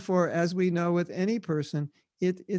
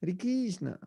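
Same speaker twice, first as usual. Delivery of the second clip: soft Italian-style "Hare Krishna", said KEEZ-na; Latin-accented "Hare Krishna" with a buzzed s; South American Italian accent; 3 semitones higher, 5 dB quieter than usual. Shown as a demonstration. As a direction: imitate an Italian or South American devotee, softly. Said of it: "more the South American Italian".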